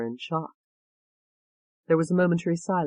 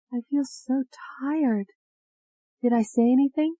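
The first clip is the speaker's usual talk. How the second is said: Ashley's tired voice; 9 semitones higher than usual. A narrator's reading. Ashley waking from hypnosis to the doctor, weary. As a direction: murmur it as you wake drained.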